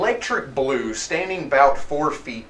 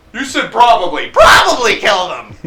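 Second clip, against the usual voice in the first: raised, loud protest; arguing henchman; 6 semitones higher, 9 dB louder than usual. Acted. Henchman insisting on what the boss said, loud and stubborn. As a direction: argue back loudly, a little higher.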